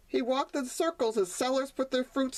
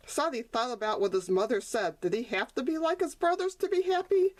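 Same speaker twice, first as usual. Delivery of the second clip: usual speaking voice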